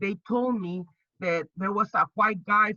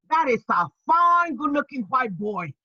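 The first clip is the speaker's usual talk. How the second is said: excited admiration; 5 semitones higher, 5 dB louder than usual. Retold or imitated. Inner thought, admiring him.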